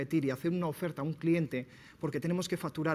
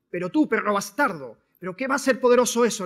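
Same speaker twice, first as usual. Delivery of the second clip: high, loud, acted tirade; angry insult; 5 semitones higher, 10 dB louder than usual. Master insulting the samurai in front of everyone, angry and loud. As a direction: hurl it as a public insult.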